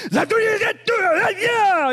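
high, loud burst of mock translation; hammed-up high-pitched shouting; shouting translator. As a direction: imitate an interpreter belting out another language. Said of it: "the guy beside me was like".

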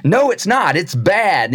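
high sharp protest; mock angry king retort. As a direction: snap back in anger. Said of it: "the king was so angry".

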